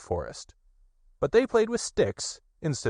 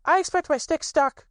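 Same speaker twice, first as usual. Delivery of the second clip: put-on very high, eager child voice; little Roo chattering; 4 dB louder than usual. A narrator's reading. Little Roo pesters his friends about their sticks, high and excited. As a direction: tumble the questions out like an impatient little one. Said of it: "said Roo".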